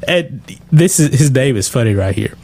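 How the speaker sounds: ordinary talk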